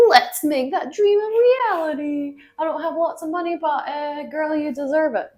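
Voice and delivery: giddy self pep talk. Retold or imitated. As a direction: hype yourself up like a best friend. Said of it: "I was like".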